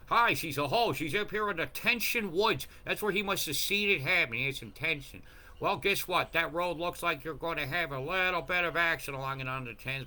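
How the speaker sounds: high, loud jeering over place names; mocking loud rant. Real, not acted. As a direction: jeer at the towns.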